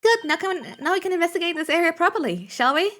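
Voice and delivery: a bright, hurried nudge to get going. Acted. Voicing eager character.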